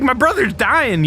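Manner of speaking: high, urgent imitation of the brother; imitating a frantic sibling; retold desperate brother. Retold brother pleading with staff.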